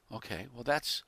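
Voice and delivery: ordinary talk, nothing marked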